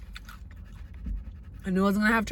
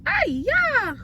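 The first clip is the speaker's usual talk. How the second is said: sudden very high pleased squeal; high squeal of delight; 4 dB louder than usual. Real, not acted. Squealing at the first bite of the burger.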